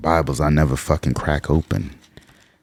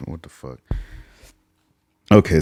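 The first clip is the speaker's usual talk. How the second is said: ordinary talk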